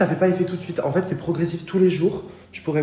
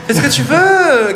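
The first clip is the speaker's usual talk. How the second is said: higher, louder, slower; exasperated shout; 10 semitones higher, 11 dB louder than usual. Real, not acted. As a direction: throw up your hands, shouting it. Repeats it louder.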